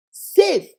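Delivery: "Safe." punched out high and loud; stressed word. Acted. Warning a mother about dating, "Safe." punched out loud. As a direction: hit "Safe." hard and high.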